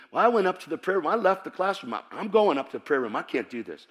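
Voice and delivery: ordinary talk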